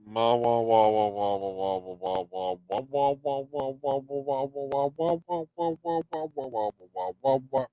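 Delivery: mock muted-trumpet wah wahs; wah-wah babble. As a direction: babble wah wah like grown-ups in cartoons. Babbling wah-wah nonsense sounds.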